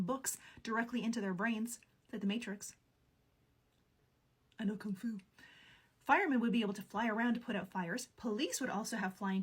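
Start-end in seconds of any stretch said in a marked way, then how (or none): 4.6-5.2 hushed whisper of a famous line; whispered movie quote. Acted. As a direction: whisper it like a secret revelation. Quoting The Matrix line.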